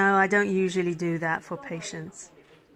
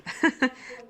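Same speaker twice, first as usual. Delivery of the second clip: breathy chuckle; 5 semitones higher than usual. Acted. Nurse amused by the patient, airy chuckle. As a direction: chuckle under your breath.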